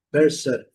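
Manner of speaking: person said pɛɾsn with a reduced O; schwa in person. Shown as a demonstration. Teacher modelling the schwa in person. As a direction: person with an unstressed O. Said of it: "a letra O com o som do schwa".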